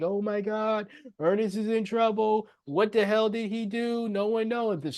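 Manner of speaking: high-pitched murmur of mock shock; shocked classmates. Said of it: "Everyone is shocked".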